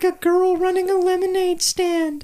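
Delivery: mock a young girl's voice; high girly voice. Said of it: "You're talking like a girl".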